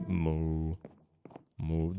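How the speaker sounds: low, drawn-out moo; long low imitation cow. Shown as a demonstration. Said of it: "have you meowed and mooed".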